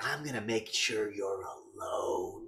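slow, drawn-out villain threat; lightly put-on threatening voice; mock menace. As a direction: stretch the last word ominously.

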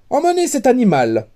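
high, quick royal order; imperious princess. A narrator's reading. Princess to her guards, quick imperious order. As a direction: dismiss the fool briskly.

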